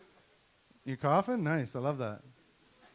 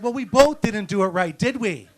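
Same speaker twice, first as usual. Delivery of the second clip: loud comeback; 5 semitones higher, 10 dB louder than usual. Retold or imitated. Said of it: "I looked at him and said".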